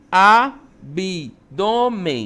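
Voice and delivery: "Abdômen" very slow and loud, syllable by syllable; teacher spacing out each syllable of "Abdômen"; split into syllables. Shown as a demonstration. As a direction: separate "Abdômen" as a-bi-dô-men. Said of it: "como é que eu separo?".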